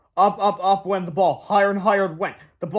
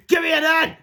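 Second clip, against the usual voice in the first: much higher, louder chant; cheerleader shout; 10 semitones higher, 5 dB louder than usual. Acted. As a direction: yell it to the crowd. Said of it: "shouted the cheerleaders".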